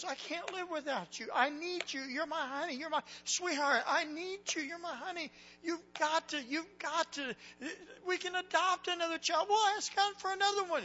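high, loud, anguished begging; desperate pleading. Retold or imitated. Husband begs his dying wife to stay, anguished. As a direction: beg her not to leave you, voice straining.

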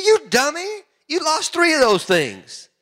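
high, exasperated mock telling-off; storyteller's incredulous high rebuke; mock scolding. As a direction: scold him in a high voice, playfully acted. Missionary retold scolding the villager.

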